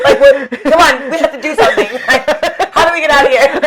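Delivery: very high, loud laughing panic; urging the calm hostess to flee, mock panic; retold panic. Retelling her panic.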